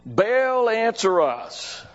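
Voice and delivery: high-pitched cry of Baal, answer us, drawn out slowly; retold shout to Baal. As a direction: call up to the god, pleading. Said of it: "they shouted".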